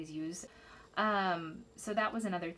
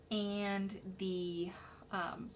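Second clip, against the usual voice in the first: ordinary talk, nothing marked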